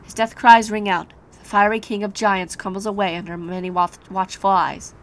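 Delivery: ordinary talk, nothing marked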